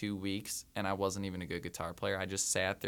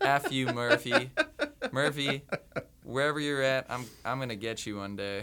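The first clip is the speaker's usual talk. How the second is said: laughing, louder mock curse at Murphy; joking outburst at Murphy's law, laughing and louder; mock angry laughing curse; 4 semitones higher, 4 dB louder than usual. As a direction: shake a fist at bad luck while laughing.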